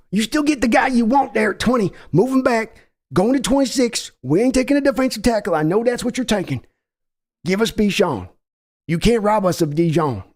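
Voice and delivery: folksy Texan owner voice, pushy; brash Texas accent of a team owner; Jerry Jones impression. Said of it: "That was me, Jerry Jones".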